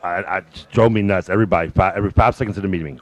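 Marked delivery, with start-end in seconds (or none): none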